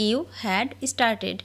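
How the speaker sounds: very slow, word by word; each word drawn out for learners to copy; slow dictation. Shown as a demonstration. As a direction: spell it out slowly for the class. Repeated slowly for learners.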